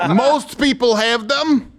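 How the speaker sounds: slow, deliberate mock Ukrainian accent; Zelensky accent. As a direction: stretch it out in a put-on Ukrainian accent. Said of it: "I'll do the accent".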